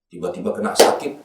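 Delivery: usual speaking voice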